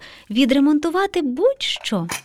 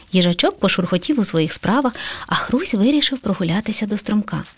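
ordinary delivery, nothing marked

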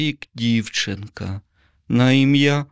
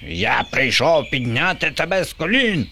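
high zombie screech; shrieking zombie; 7 semitones higher than usual. A narrator's reading. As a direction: screech it like a pompous tyrant. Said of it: "верещав Боба". (верещить високим голосом)

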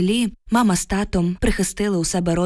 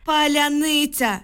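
high, drawn-out narrated scream, contained volume; all-out cry; 9 semitones higher, slower than usual. A narrator's reading. Woman shouting password as a soldier takes aim, high drawn-out cry. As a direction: stretch the word in a desperate high cry. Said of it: "щосили прокричала я".

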